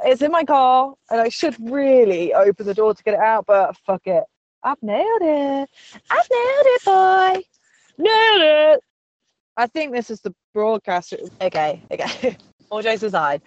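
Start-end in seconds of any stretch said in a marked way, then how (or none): 4.6-5.7 playful boasting. Pretend. Host celebrates, first quieter take. 6.1-7.4 triumphant crowing. Pretend. Host boasting of success, high triumphant crowing. Host celebrates, second bigger take. 8.0-8.8 high, loud, stretched victory cry; triumphant shout. Pretend. Host capping the boast with a triumphant shout. Host celebrates, last take.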